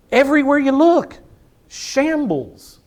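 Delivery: a pulpit cry of alarm; preaching outcry. Real, not acted. Preacher to congregation about the nation, loud high outcry. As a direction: raise it high and loud, appalled.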